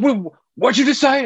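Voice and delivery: high, loud, menacing New York guy; tough New Yorker. Retold or imitated. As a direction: challenge him like a New Yorker spoiling for a fight. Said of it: "the quintessential New Yorker kinda guy".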